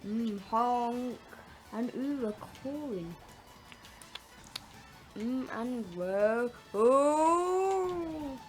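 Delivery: ordinary talk, nothing marked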